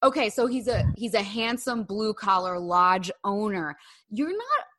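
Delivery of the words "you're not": ordinary talk, nothing marked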